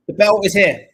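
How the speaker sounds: loud triumphant reveal of the cheese. Real, not acted. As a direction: present it like a trophy. Proudly presents cheese.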